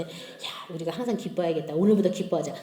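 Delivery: low, soft motherly urging; understated, low-pitched encouragement; soft exhortation. Retold or imitated. Mother exhorting her children.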